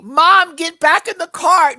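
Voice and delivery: put-on high, loud teen yell at mom; mock daughter yell. Daughter yelling at her mom to get back in the car, loud and high. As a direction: bark it like a fed-up daughter.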